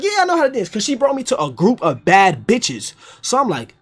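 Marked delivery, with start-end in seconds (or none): 0.0-0.7 very high-pitched insistence; retold high-voiced eager yes; mock confident boast. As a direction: brag it in a high squeal. Boasting to the girls.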